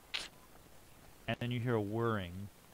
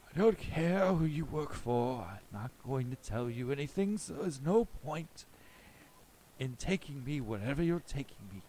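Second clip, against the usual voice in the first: put-on higher-pitched defiant old captive; raised, wavering-pitch elder standing firm; elderly person's voice; 5 semitones higher than usual. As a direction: play an old man refusing to talk. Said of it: "sounds like an elderly person".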